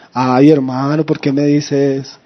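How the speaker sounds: low, wounded protest in imitation; mock plaintive. Churchgoer answering a knowing brother, mock plaintive. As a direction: play a put-upon churchgoer complaining. Mimics a brother hurt by advice.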